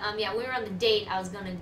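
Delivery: ordinary talk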